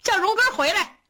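high, loud call toward the window. A narrator's reading. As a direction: call him back from across the yard. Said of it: "冲着窗外大声说". (冲窗外高声喊)

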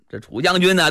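ordinary delivery

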